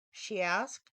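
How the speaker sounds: usual speaking voice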